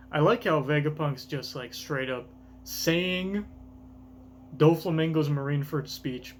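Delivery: ordinary talk, nothing marked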